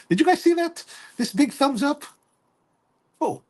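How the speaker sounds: high, laughing disbelief at the thumbs up; startled laugh. Real, not acted. Host reacting to the pop-up thumbs up, surprised laughing. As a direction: blurt it out, half laughing, caught off guard.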